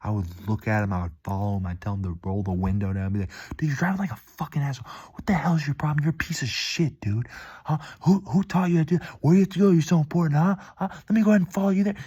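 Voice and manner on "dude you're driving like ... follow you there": high, escalating angry tirade, getting louder; acted-out road rage. Driver imitating his past self yelling through a car window, enraged. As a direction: berate the other driver as if losing it. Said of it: "I would have absolutely lost it".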